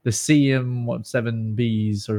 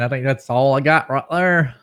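Southern twang; 4 dB louder than usual. Pretend. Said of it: "add a little more Southern twang".

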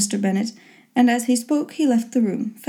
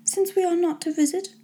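high-pitched, lightly sulky mother voice; aggrieved mother in a high register; resentful mother; 8 semitones higher, 4 dB quieter than usual. A narrator's reading. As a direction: voice a put-out mother, pitched well up. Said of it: "her mother resentfully".

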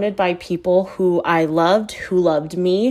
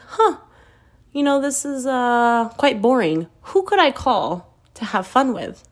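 higher-pitched musing, easing down; mock pondering; 4 semitones higher than usual. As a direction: play at innocently hatching an idea. Acts out her tipsy scheming.

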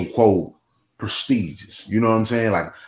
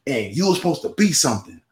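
higher-pitched mock tirade from grandma; imitating an angry grandmother, pitched up; retold cussing out; 8 semitones higher than usual. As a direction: rattle it off like a scolding grandma. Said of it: "she was cussing me out".

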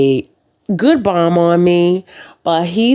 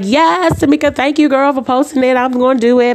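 excited; 10 semitones higher, faster than usual. Retold or imitated. Retelling her sudden birthday idea.

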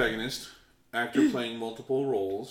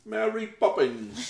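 high-pitched put-on Cockney; mock Cockney; 10 semitones higher than usual. Player mocking the film's Cockney accent, high put-on Cockney. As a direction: do a playful, high Cockney impression. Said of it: "that Cockney accent".

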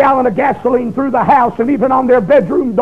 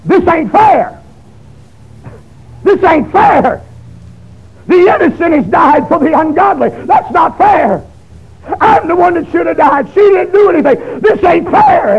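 higher and louder, crying out; anguished protest; 5 semitones higher, 3 dB louder than usual. Retold or imitated. Young man crying out over the dead girl, anguished. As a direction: rail against the injustice. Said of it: "went through my mind".

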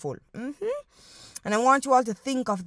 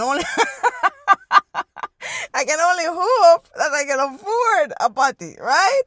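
laughing through the words, higher; 6 semitones higher, 6 dB louder than usual. Real, not acted. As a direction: ride a wave of real laughter. Joking about patty prices.

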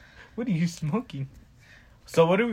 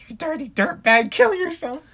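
high-pitched mock insult; mock outraged texters; 12 semitones higher than usual. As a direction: put on a high, hammed-up voice of angry texters.

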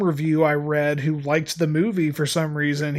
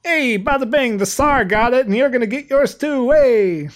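higher, louder mock Bronx tough guy; hammed-up Bronx accent; 7 semitones higher, 3 dB louder than usual. Mocking the Bronx-accented Mario in a bad movie, hammed-up Bronx accent. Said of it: "I'm not gonna milk this accent".

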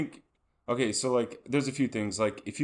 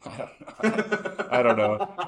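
laughing; much slower than usual. Real, not acted. Laughing at friend's joke.

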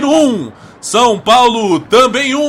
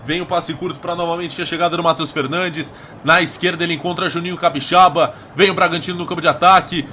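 fast, low radio play-by-play; 6 semitones lower, 5 dB quieter, much faster than usual. Acted. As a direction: rattle off the action quickly.

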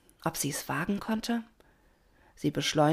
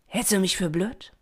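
irritated rebuke with raised pitch; snapping; 3 semitones higher than usual. A narrator's reading. Doña Rosa rebuffs Pina, snapping irritably. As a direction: snap at the silly request. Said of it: "Doña Rosa fuhr sie an".

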